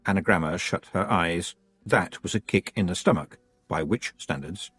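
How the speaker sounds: ordinary delivery, nothing marked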